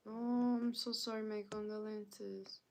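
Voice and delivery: usual speaking voice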